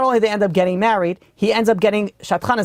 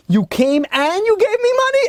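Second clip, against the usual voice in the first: very high, astonished retold outburst; overjoyed amazement; 13 semitones higher than usual. Father cuts off the rabbi on hearing of the gift, overjoyed. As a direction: squeal it like a delighted, disbelieving father. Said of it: "He's so happy".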